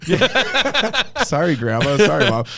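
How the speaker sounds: high, loud laughing admission; embarrassed laughing. Real, not acted. Laughing as he admits his arrest to the host. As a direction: laugh through the confession.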